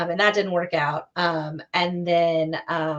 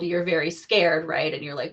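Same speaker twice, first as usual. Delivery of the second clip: ordinary delivery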